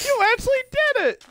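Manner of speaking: very high-pitched, thrilled mock exclamation; excited squeal. Friend re-enacts amazement at a wrestler's win, squealing excitedly. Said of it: "I actually sounded so excited".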